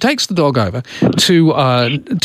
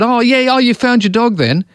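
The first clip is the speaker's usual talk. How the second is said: chirpy neighbour; 8 semitones higher than usual. Retold or imitated. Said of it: "this woman said".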